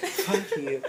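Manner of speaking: high, laughing comeback; high-pitched giggly swearing; laughing retort. Real, not acted. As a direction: curse a friend through laughter. Laughing at friend's teasing jab.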